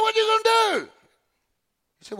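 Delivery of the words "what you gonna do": much higher, louder, taunting tone; mocking high dare. Pastor taunting the father who won't act, mocking and high. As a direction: toss it up high to taunt him. Challenge repeated twice to the man.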